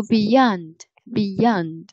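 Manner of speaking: slow demo of "beyond" with weight on "-yond"; stress on second syllable. Shown as a demonstration. Tutor slowly modeling beyond for her student, stress on second syllable. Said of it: "重音在第二个音节上面".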